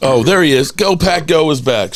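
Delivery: ordinary talk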